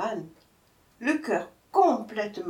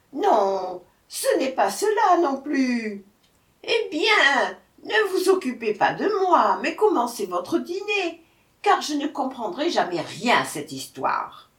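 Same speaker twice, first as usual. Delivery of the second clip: high, loud, flustered giving up; despairing jackal; 6 semitones higher, 5 dB louder than usual. A narrator's reading. Jackal telling tiger he'll never understand, despairing. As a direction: wail it in mock despair.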